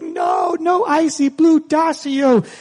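very high mock child wail of protest; upset little boy. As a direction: imitate a kid refusing, drawing out the last words. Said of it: "And he's like".

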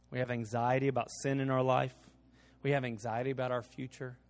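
soft, low invitation; preaching altar call. Acted. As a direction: speak it gently over music. Closing altar call prayer.

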